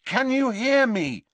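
high, loud, insistent question; loud repeat. A narrator's reading. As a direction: call it out loudly to rouse him. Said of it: "he repeated loudly".

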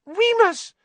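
very high, loud squeal; panicked squeak. A narrator's reading. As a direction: squeak the name desperately. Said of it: "Pettigrew squeaked".

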